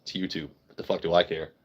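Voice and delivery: ordinary talk, nothing marked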